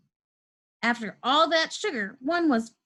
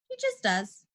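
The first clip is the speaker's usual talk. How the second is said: mother's voice; 13 semitones higher, 3 dB quieter than usual. A narrator's reading. Mom voiced answering Henry.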